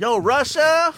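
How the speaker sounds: high, brash comedian impression; hammed-up shout-out; Tiffany Haddish. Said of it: "did fucking Tiffany Haddish".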